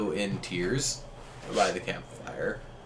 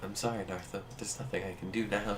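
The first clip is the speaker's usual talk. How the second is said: soft apology; 8 dB quieter, faster than usual. Acted. Honey gives bad news gently.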